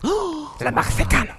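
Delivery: très aigu, lent et étiré; exclamation mexicaine jouée. Pretend. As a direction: surjoue l'enthousiasme d'un Mexicain. Annonce de la rubrique mexicaine. (cri mexicain caricatural, aigu et lent)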